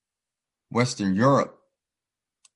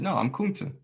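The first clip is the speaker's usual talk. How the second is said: retold defiance in a higher pitch; defiant refusal; 8 semitones higher than usual. Kunta refusing the name Toby, retold defiant refusal.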